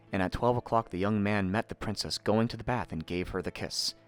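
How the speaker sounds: ordinary delivery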